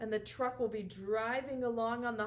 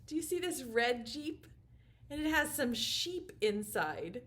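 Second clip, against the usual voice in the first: ordinary talk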